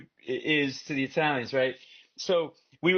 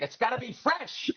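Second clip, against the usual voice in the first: higher-pitched uncle impression; imitating uncle; 7 semitones higher than usual. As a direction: play the uncle insisting on freshness. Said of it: "And he's like".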